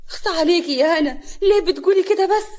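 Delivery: high, wounded mock reproach; put-on sorrowful whine; feigned sadness. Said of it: "كريمه بتمثيل الحزن".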